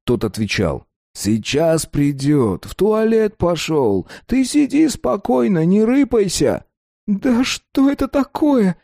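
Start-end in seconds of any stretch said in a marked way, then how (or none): 1.1-6.6 high, slow, unbothered tough guy; calm thug voice. A narrator's reading. Mamud voiced as character. 7.0-8.8 put-on high, slow, childish whine; childlike. A narrator's reading. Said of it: "по-детски спрашивал Кока".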